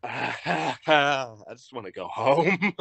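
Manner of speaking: retold plea through a laugh; half-laughing, mock-defeated whine; laughing despair. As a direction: laugh it out as if worn down. Acting out weary reaction, laughing.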